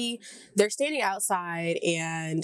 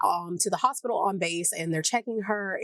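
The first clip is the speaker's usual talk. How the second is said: usual speaking voice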